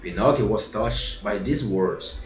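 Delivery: ordinary delivery, nothing marked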